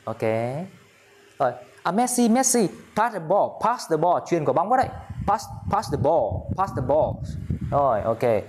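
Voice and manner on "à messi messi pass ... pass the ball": high-pitched call to Messi on the pitch; calling for the ball. Pretend. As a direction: call out eagerly, as a pretend teammate wanting a pass. Calls to Messi for a pass.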